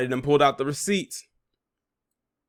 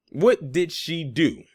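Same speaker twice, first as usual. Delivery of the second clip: slow, higher, each word pointed; slow pointed repeat; 4 semitones higher, much slower than usual. Real, not acted. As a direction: drag it out to make the point. Rhetorical question repeated.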